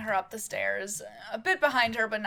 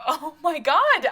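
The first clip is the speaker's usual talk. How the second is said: slow exasperated cry, louder and higher; 7 semitones higher, 9 dB louder, slower than usual. Acted. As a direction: at the end of her rope with her husband. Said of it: "tossing her head into her hands".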